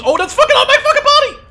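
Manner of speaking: very high, loud shriek breaking into a laugh; panicked laughing shriek. Real, not acted. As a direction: scream as the grenade lands on you. Grenade lands on him.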